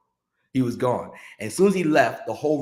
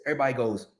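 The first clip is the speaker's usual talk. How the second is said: ordinary talk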